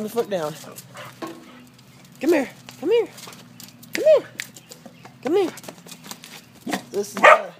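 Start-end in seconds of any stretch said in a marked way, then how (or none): 2.2-5.5 calling the dog. Real, not acted. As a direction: coax the dog over in a high call.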